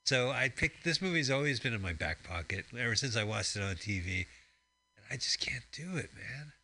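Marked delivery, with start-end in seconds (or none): 5.0-6.5 whisper. Real, not acted. Confessing he can't watch.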